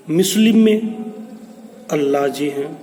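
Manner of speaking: normal talking voice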